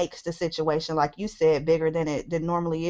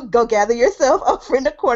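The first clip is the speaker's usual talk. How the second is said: laughing through a sassy put-down; mock-scold her into the corner, laughing; 5 semitones higher, 6 dB louder, faster than usual. To the co-hosts, laughing and scolding. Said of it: "I hear".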